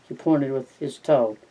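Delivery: ordinary delivery, nothing marked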